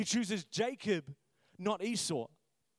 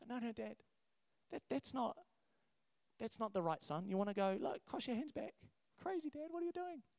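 put-on higher, softer talking-down to an old dad; condescending to elder; 4 semitones higher, 7 dB quieter than usual. Joseph talking down to his old father, higher and hushed. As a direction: coax him like a child. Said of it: "speak a little bit condescendingly to older people".

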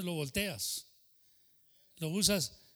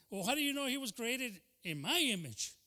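higher, mock indignant challenge; indignant comeback; 7 semitones higher than usual. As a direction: fire the question back at Archie. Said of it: "Y dice".

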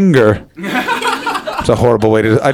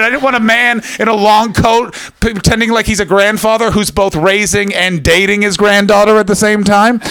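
ordinary talk, nothing marked

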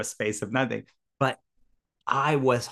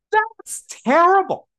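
high, loud mock horror; imitating a friend's high, loud dismay; shocked; 8 dB louder than usual. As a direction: recoil at the idea, much higher. Friend's shocked reaction retold.

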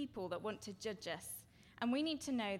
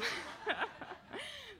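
short laugh at herself; amused laugh at her husband's word; 13 semitones higher than usual. Real, not acted.

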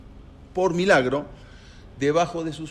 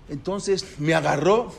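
ordinary delivery

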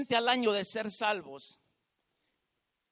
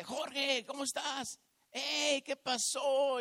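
higher-pitched mock hail to an old friend; imitated eager greeting; 5 semitones higher, much slower than usual. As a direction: greet a long-lost buddy, without shouting. Retold surprise greeting from old acquaintance.

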